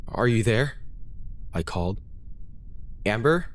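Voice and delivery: plain reading voice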